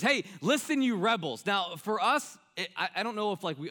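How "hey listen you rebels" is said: higher, louder mock scolding of rebels; angry rebuke. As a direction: play Moses losing his temper, voice up and louder. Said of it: "you can hear it in his voice".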